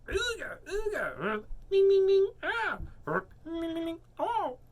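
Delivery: very high-pitched goofy hoots and squeaks; wound-up cartoon sound effects; silly nonsense noises. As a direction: squeak out a string of daft noises. Silly noises after told to breathe.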